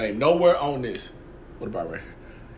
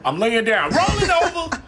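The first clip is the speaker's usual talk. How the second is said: eager shout; 7 semitones higher than usual. Acted. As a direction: blurt it out to win the treat. Dog eager for cookie.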